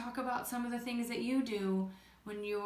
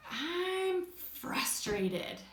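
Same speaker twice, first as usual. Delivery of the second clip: slow, higher, drawn-out frustration; frustrated; 6 semitones higher, much slower than usual. Acted. Teacher acts someone in the yellow zone, frustrated. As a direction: act out exasperation, dragging the words. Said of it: "And they could say".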